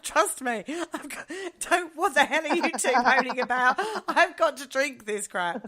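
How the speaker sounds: high, loud, laughing through the words; exasperated and cracking up while telling it; loud, giggly mock complaint at the housemates; laughing exasperation. Retelling her own exasperated reply.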